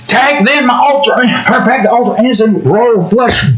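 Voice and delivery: high mock voice, taunting the loser; high gloating card player taunt. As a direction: crow over the winning hand. Acted winner's taunt.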